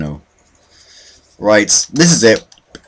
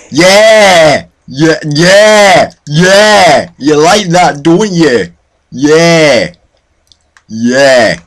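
loud, high, drawn-out triumphant yeahs; gloating yells; 5 semitones higher, 5 dB louder, much slower than usual. Real, not acted. Gamer taunting someone he killed, gloating yells. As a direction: crow loudly over your victim. Gloating over kills in game.

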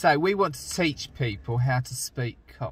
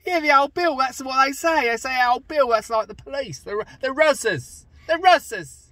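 higher, louder hammed-up Cockney; 9 semitones higher, 5 dB louder than usual. Shown as a demonstration. Teaching Cockney slang in a loud, hammed-up accent. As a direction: bark the slang like a cheeky Londoner.

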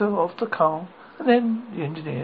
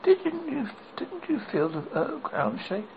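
put-on little girl voice; 10 semitones higher than usual. A narrator's reading. Dorothy asks.

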